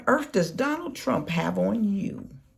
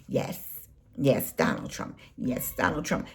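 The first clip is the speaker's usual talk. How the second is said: lower, softer mock yes-man; mock servile yes-man; 8 semitones lower, 6 dB quieter than usual. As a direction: parrot the boss like a meek follower. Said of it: "All you do is say".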